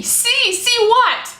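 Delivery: high, loud alarmed demand; panicked questioning. Acted. Acts out her panicked reply.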